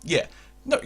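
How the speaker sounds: usual speaking voice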